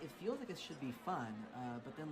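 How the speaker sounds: ordinary talk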